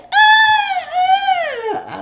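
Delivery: very high drawn-out "ah"; imitated high squeal. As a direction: squeal it out in mock delight. Imitates joker's joyful noise.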